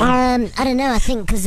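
squeaky helium-high voice. Real, not acted. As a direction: talk after sucking helium. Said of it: "You actually look a bit like Taylor Lautner".

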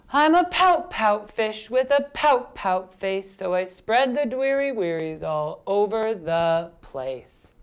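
put-on slow, heavily accented rhythmic refrain; gloomy pout-pout fish. Fish tells of his pouty face, slow gloomy chant. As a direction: chant the fish's woe, stretching the ending.

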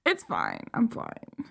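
low, soft, barely holding together; calm yourself down under your breath; quiet self-soothing. Real, not acted. Tearful fan insisting all is fine, quiet self-soothing. Composing herself while tearful.

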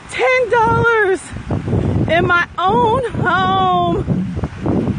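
slow, high and emphatic; excited. Real, not acted. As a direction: savor each word with delight.

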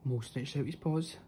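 ordinary delivery, nothing marked

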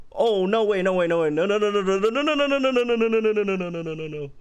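overwhelmed with glee, chanting no; excited no no no. Real, not acted. Streamer discovering a shortcut, gleeful rapid noes. Gamer thrilled by new menu shortcut.

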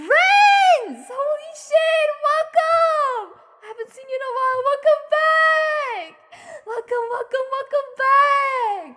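high, loud, stretched-out squeal; overjoyed welcome. Real, not acted. Streamer greeting a long-missed viewer, overjoyed welcome. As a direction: cry out each welcome, dragging the last word.

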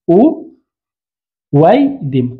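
"أو ويدم" in two parts, u, pause, waydim; split demonstration. Shown as a demonstration. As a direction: separate "أو ويدم", w replacing the hamza. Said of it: "إذا سنقول ماذا". ("أو ويدم" مقطعة بالواو)

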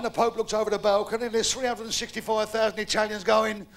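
ordinary talk, nothing marked

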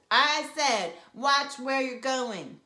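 loud, high, slow shout. Acted. Sister shouting into brother's ear. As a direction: spell it out loudly into his ear. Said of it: "I shouted".

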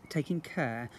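usual speaking voice

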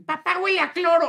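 put-on high innocent little boy; child voice. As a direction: say it like a small child. (niño pequeño hablando inocente)

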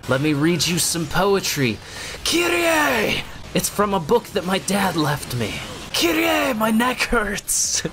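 high, hammed-up yelling over trivia; Nero, intensely dramatic. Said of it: "inexplicably yelling her name".

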